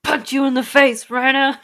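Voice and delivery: mock angry. Angry at villain's greeting.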